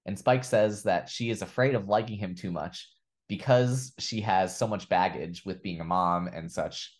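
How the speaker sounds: ordinary talk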